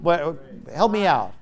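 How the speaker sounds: ordinary talk, nothing marked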